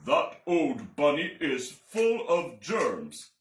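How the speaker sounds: slow, loud stern doctor. Acted. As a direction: declare it gravely. Doctor pronounces.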